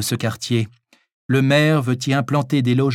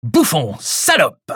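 yelling; 4 semitones higher, 3 dB louder, slower than usual. Acted.

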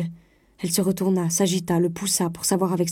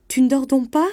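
higher, louder startled question; wife taken aback, voice raised; 7 semitones higher, 6 dB louder than usual. A narrator's reading. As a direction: ask in sudden alarm.

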